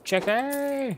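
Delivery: high, sing-song call of Chicky; playful call. Real, not acted. Calls out chicken suggestion.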